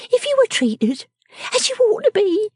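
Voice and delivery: very high, commanding character voice; bossy little scold. Acted. Miss Wren demanding the wretched man's money, high and bossy. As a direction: order him about in a high, sharp little voice.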